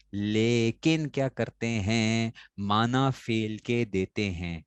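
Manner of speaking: very slow, stretched syllables; slow singsong recitation for pupils to follow; drawn-out drilling. Shown as a demonstration. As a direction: lead the class through it word by word, slowly. Teacher drilling a definition for pupils.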